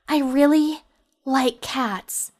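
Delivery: high, halting anime delivery with long pauses; stilted anime line. Shown as a demonstration. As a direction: break the line with awkward pauses, like an anime dub. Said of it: "it's very hard to sell that delivery".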